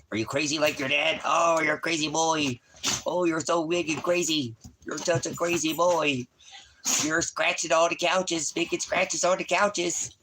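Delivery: high sing-song voice for the dog. Real, not acted. Baby talk to the dog.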